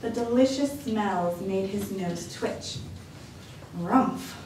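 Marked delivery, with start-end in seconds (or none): 3.8-4.3 bear grunt. A narrator's reading.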